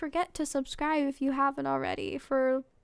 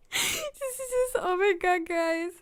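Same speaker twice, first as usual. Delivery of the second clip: high, laughing through the words; cringe laughter; 6 semitones higher than usual. Real, not acted. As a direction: crack up at the cringe. Cringing at the romance scene.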